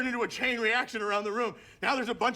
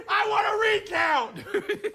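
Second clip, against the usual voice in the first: laughing demand; 10 semitones higher, 4 dB louder, slower than usual. Real, not acted. Joking demand after lost bet.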